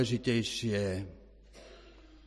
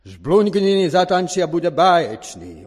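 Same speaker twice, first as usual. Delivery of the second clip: very loud, high show announcement; full-force ringmaster proclamation; announcer voice; 7 semitones higher, 12 dB louder than usual. A narrator's reading. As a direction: belt out the billing to the whole theatre. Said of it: "oznámil hlas".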